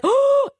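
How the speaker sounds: high, loud, drawn-out mocking "ooh"; mocking hoot. Streamer mimics what the man sounds like, mocking high hoot. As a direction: hoot it high, imitating how someone sounds. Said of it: "that's what you sound like".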